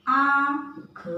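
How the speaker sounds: आँख stretched, आँ through the nose; teacher showing the chandrabindu sound; slow nasalized vowel. Shown as a demonstration. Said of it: "इस पे क्या लग रहा है? अनुनासिक".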